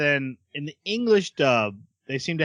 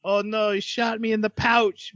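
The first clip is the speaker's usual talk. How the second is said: put-on Australian accent; 8 semitones higher than usual. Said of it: "heavy Australian accent".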